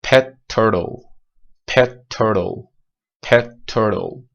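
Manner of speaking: pet turtle with the t held before release. Shown as a demonstration. Teacher demonstrating the held t in pet turtle.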